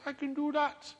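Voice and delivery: high little fish voice. Acted. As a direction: make it a small magical fish piping up. Fish grants the final wish.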